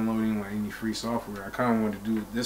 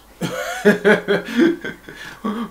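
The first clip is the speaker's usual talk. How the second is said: loud laugh; 10 semitones higher, 8 dB louder than usual. Real, not acted. Laughing about not his house.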